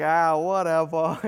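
dismissive put-on shrug of a voice; mock indifference. Parent of later children, mock indifference. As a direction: toss it off as if past caring.

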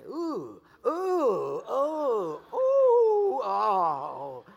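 loud, swooping oohs and oh; exaggerated onlooker reaction. People look at him and can't see past it, exaggerated oohs.

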